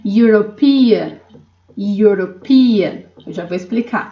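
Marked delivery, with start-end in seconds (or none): 0.1-3.0 European starting with a "you" sound, said slowly; English European. Shown as a demonstration. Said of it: "A European couple". (European com som de "iu", bem devagar)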